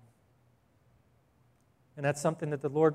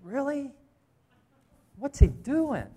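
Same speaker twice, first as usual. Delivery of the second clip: incredulous aside; 8 semitones higher, slower than usual. Retold or imitated. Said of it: "I'm just like".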